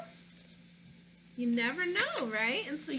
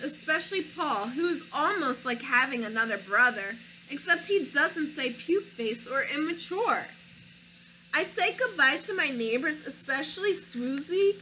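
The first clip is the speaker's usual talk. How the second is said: put-on lively read-aloud for children; story narration; 4 semitones lower, 6 dB louder than usual. A narrator's reading. Reading a picture book to children, lively storybook narration. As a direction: tell it like a picture book to kids.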